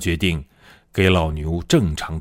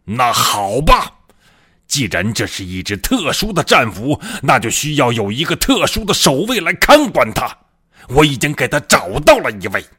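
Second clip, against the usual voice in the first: angry roar; 6 semitones higher, 3 dB louder than usual. A narrator's reading. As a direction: narrator acting an enraged general, raised pitch. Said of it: "怒吼道". (将军愤怒地吼道)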